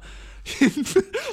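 very high, loud squeal of a laugh. Real, not acted. Laughing at his own gym story, very high. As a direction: burst out in a shrill laugh.

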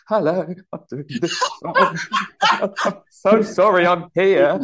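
slow, high, giggling through it; laughing nervous apology. Retold or imitated. Comic reliving his disastrous first gig, laughing nervously. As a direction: laugh out an embarrassed hello.

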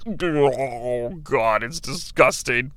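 low, soft groan of disgust. Acted. Commentator reacting to a fork in the eyes, disgusted. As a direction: recoil queasily, voice dropping low.

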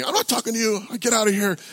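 high-pitched mock angry dismissal; mad heckler. Heckler dismissing the preacher's questions, imitated anger. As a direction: imitate a belligerent man brushing someone off. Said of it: "He's mad".

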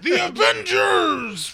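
big, high, drawn-out roar; over-the-top booming cry; hammed-up villain shout. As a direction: bellow it slowly like a comic-book villain. Hammy villain cry amid joking.

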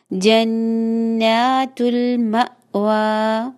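slow जन्नातुल मअवा with a held na; slow correct stretch. Shown as a demonstration. Teacher recites the phrase correctly. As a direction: hold the na in जन्नातुल two counts. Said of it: "खड़ा जबर है".

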